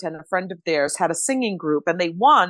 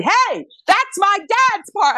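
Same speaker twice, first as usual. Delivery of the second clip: much higher and louder, slow, shouting out a window; four-year-old yelling at a space-stealing driver; indignant child yell; 13 semitones higher, 6 dB louder, slower than usual. Retold or imitated. Little girl yelling from the car window at the driver, indignant. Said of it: "rolled the window down and started".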